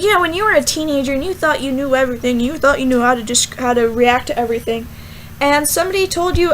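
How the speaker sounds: ordinary delivery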